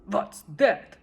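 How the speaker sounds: loud indignant snort. A narrator's reading. King snorting at the rising moon. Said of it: "snorted Yertle".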